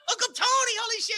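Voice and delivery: very high, loud mock amazement; acted excited shout. Performer spotting his uncle mid-show, acted high excited yell. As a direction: yell back in hammed-up surprise.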